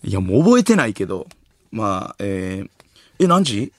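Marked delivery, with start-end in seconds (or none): 3.2-3.7 higher, louder impression of someone checking the last train; surprised one-sided conversation, pitched up; person asking last-train times. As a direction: play a caller reacting to each answer. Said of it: "みんなの終電聞く人じゃないですか".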